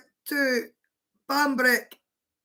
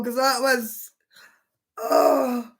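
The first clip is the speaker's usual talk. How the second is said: ordinary delivery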